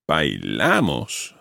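"bailamos" slow, leaning on la; slow stressed model. Shown as a demonstration. Teacher models the conjugation for student, slow and stressed. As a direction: draw out "bailamos" with clear stress on la. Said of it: "get the stress right here".

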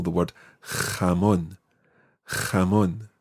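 "jamón" with the j as a harsh throat roll; guttural j. Shown as a demonstration. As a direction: rasp the j of "jamón" at the back. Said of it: "a sound that sounds something like".

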